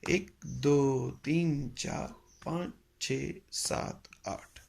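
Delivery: very slow, drawn-out count of eight; slow beat count. Shown as a demonstration. Teacher counting kahrva in Hindi numbers, very slowly. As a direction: stretch each number across a beat. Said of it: "मोटा मोटा सीखे तो हम लोग ऐसे बोलते हैं".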